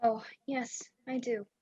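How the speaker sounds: soft near-whisper; hushed, drained. Acted. Frightened woman agrees she needs a night's rest, hushed. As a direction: agree very quietly, voice falling away.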